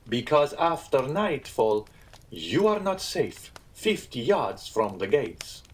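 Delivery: slow, deliberate, emphatic caution; slow warning. Acted. Innkeeper warning guests about the gates after nightfall, deliberate. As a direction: draw each phrase out as a warning.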